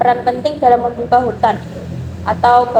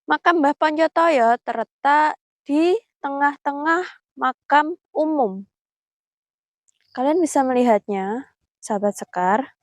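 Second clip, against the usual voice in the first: hushed, careful vlog voice; nervous night vlog whisper; 5 dB quieter than usual. Real, not acted. Hushed talk at the grave.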